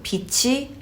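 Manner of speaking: 빛이 linked with an aspirated "chi"; rolled-over consonant. Shown as a demonstration. Teacher repeats light for learners, rolled-over consonant. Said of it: "치 rolls over".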